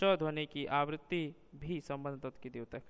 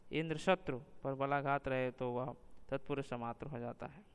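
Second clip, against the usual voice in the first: ordinary talk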